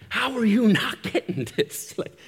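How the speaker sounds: mock the wife yelling in frustration; wife's exasperated yell. Wife yelling at him over his academic writing, exasperated shout. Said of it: "she will actually yell at me".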